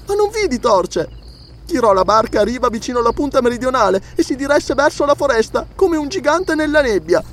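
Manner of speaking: put-on high-pitched child recounting something eerie; frightened little girl. A narrator's reading. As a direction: tell it as a scared child, voice held high. Frightened child tells her sighting.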